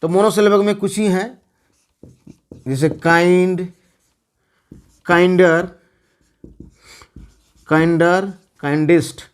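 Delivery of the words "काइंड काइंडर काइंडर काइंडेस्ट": slow dictation. Shown as a demonstration. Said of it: "मैं दे दे रहा हूँ, आप टाइप लिख लीजिए".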